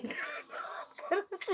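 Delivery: a breathy, high-pitched stifled giggle. Real, not acted. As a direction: giggle quietly under your breath. Laughing at comment. (दबी हुई हँसी)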